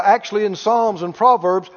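ordinary delivery

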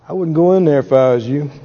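low, knowing warning from the angel of the Lord; angel's warning. Retold or imitated. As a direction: lean in and warn him off, low and sly. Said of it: "lean over on him and go".

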